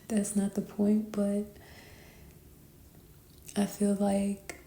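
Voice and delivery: ordinary talk, nothing marked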